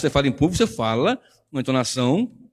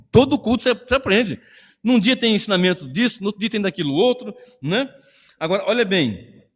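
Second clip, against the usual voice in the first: usual speaking voice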